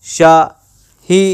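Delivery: Shahida very slowly, long gaps between शा, ही, दा; slow split demonstration of Shahida; broken into syllables. Shown as a demonstration. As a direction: take Shahida apart syllable by syllable. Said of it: "Shahida का अगर आप action करेंगे".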